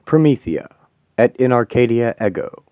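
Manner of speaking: a magician's chanted incantation; solemn invocation. Acted. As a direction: call it out like a spell.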